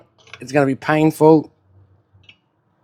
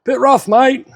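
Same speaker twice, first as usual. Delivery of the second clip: high, loud mock commenter; 10 semitones higher, 4 dB louder, slower than usual. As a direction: imitate a snide commenter, pitched up. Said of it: "Wait for the comments on that".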